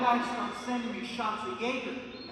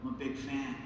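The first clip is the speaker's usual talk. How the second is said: calm bartender; 8 semitones lower than usual. Retold or imitated. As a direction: talk him down, easy and low. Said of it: "he goes".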